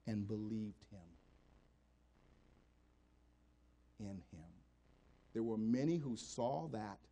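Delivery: low intimate storytelling from the pulpit; hushed preaching. Acted. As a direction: draw them in with a near-murmur. Hushed reading again.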